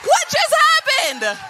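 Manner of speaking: can't believe the news, yell it; thrilled disbelief. Retold or imitated. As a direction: friend calling back overjoyed, high and loud. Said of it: "has to call you and say".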